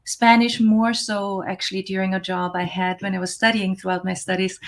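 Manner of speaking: ordinary delivery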